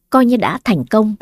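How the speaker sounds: ordinary delivery, nothing marked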